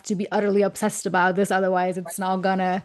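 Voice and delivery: ordinary talk, nothing marked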